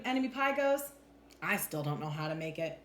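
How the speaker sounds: plain reading voice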